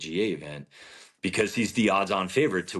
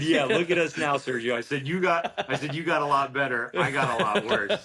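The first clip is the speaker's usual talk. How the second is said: laughing tease; 7 semitones higher than usual. Retold or imitated. Joking with Sergio, laughing.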